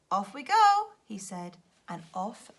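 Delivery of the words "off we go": high, loud, gleeful launch; a child setting off on an adventure; excited cheer. Acted. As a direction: cheer as the plane lifts off. Children take off in plane.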